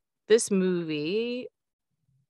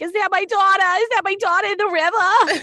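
a much higher, loud, hammed-up Boston accent; terrible Boston accent; 8 dB louder than usual. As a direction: do a bad Boston accent as the husband, half yelling. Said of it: "that was a terrible impression of the dialect".

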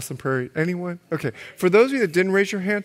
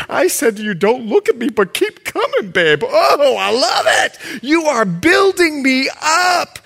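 loud, high gushing delight; hammed-up thrilled girlfriend; 8 semitones higher, 8 dB louder than usual. Preacher playing the swooning woman, loud and thrilled. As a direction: gush over him, louder and louder. Woman acted getting excited.

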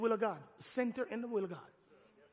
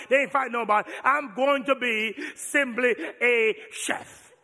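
loud comic sermon rant; preaching at full force; 6 semitones higher, 12 dB louder than usual. Real, not acted.

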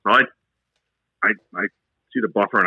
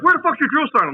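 drill sergeant yell; 13 semitones higher than usual. Retold or imitated. Drill sergeant yelling at recruit.

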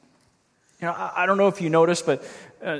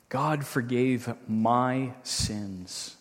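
usual speaking voice

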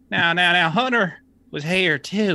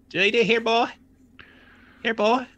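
calling a lost pet; 5 semitones higher than usual. Acted. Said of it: "I'll just start whistling".

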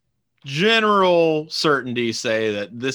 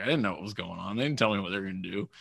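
usual speaking voice

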